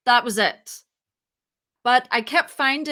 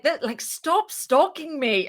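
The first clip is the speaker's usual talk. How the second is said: slower, higher, half-joking stop stalking me; mock accusation; 5 semitones higher than usual. Jokingly telling the other founder to stop stalking, mock accusing. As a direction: tease her that she is the stalker. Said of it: "I was just like".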